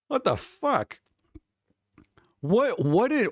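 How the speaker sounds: high, incredulous what the fuck. Real, not acted. Streamer reacting to a coworker story, incredulous. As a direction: pitch jumps up in disbelief.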